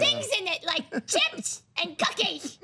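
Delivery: put-on high, excited childlike voice; tiny kid voice. Shown as a demonstration. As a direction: squeal it like a kid wanting dessert. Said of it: "Can you say that now?".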